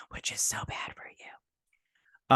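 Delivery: slow, hushed whisper; whispered aside. Real, not acted. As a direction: drop into a confessional whisper. Confessional aside about eating donuts.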